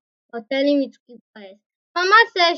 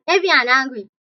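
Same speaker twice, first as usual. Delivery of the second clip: usual speaking voice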